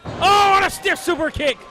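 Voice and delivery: ordinary delivery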